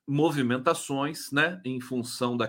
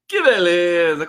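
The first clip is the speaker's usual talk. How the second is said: excited exclamation; 4 semitones higher, 9 dB louder than usual. Retold or imitated. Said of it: "Como diria [name]".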